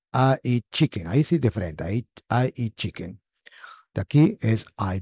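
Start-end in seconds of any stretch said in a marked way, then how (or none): none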